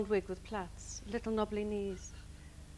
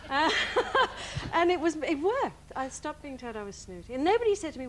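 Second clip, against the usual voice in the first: ordinary talk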